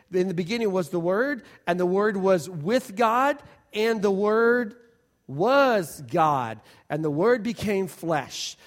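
scripture reading. Acted. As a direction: read John one with weight. Reads scripture solemnly.

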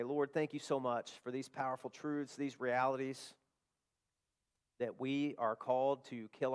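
prayer. Acted. Pastor closing the sermon, praying softly to God. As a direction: pray quietly over the congregation.

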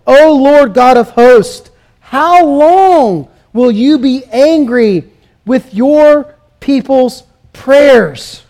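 slow, drawn-out lament reading; slow solemn prayer; slow scripture reading. Acted. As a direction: read the verse slowly, like a mournful plea. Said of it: "Look at verse four".

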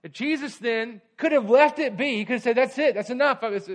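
fast, loud, high sermon drive; full-force preaching. Acted. Preacher urging the church, fast and loud.

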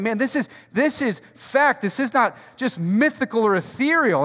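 loud, driving sermon build; full-force preaching. Acted.

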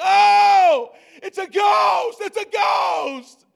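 terrified high shout of disciples; frantic shout. Retold or imitated. Frightened disciples in the storm shouting at the figure. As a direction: scream it like you've seen a ghost. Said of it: "they begin to shout and begin to be frantic".

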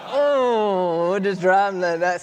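a flustered, hurried take-back; flustered backpedal. Retold or imitated. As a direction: scramble to cover it up. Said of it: "And I was like".

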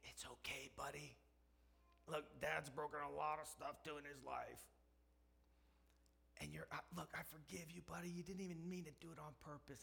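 put-on soft hushed reassurance to a child; comforting whisper. Dad soothing his crying son in his lap, soft whisper. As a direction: gently soothe him, barely voiced.

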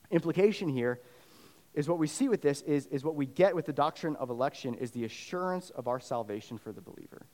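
usual speaking voice

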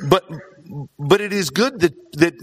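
ordinary delivery

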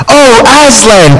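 put-on the children greet Aslan, a mild joyful cry. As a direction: gasp it out in delight. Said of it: "cried both the children".

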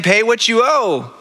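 high, slow, sneering demand; mock demanding servant. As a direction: mimic the hypocrite's grab for money. Preacher mimics the unforgiving servant.

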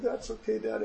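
lightly voiced small child consoling Dad; five-year-old's voice. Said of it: "And he said".

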